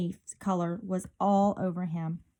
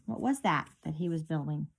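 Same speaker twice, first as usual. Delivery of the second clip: ordinary delivery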